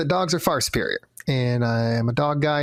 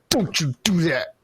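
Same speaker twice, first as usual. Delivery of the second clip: stern scolding; 4 semitones higher than usual. Retold or imitated. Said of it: "And I'm like".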